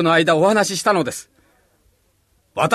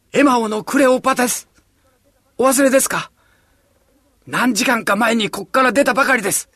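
calling through the door; 6 semitones higher than usual. Acted. Disciple begging friends behind a locked door, rising urgent call.

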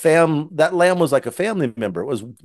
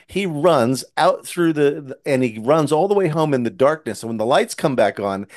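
ordinary talk

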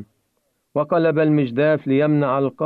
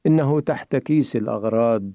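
usual speaking voice

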